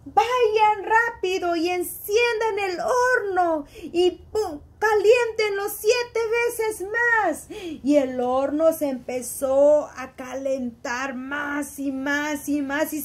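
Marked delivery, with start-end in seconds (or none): 0.1-7.5 higher, half-shouted royal order; angry king. Acted. Furious king ordering his servants to heat the oven. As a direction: bark the command to heat the furnace. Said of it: "el rey se enojó mucho y dijo".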